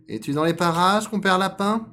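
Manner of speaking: loud, high, rising call; searching fox. Acted. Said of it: "questionna le renard".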